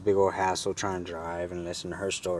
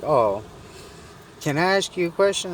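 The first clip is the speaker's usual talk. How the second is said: high-pitched, louder mock lady voice; imitated woman; 9 semitones higher, 4 dB louder than usual. Stranded lady asks the narrator for help, in mock high voice. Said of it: "And she's like".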